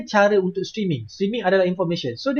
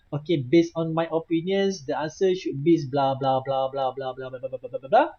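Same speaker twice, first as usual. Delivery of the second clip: low monotone dictation, trailing into blahs; mock dictating; 4 semitones lower than usual. Teacher showing students phone dictation, mock dictating. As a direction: reel off a pretend answer on autopilot.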